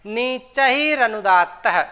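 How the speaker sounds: नीचैरनुदात्तः recited slowly and deliberately; slow classroom recitation of नीचैरनुदात्तः; slow sutra recitation. Shown as a demonstration. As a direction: spell out नीचैरनुदात्तः at a slow teaching pace. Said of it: "तो वो अनुदात्त स्वर कहलाता है".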